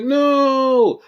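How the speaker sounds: mock shout. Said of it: "was like".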